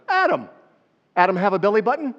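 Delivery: high, loud, quick playful question; spring the joke on them; teasing punchline. Real, not acted.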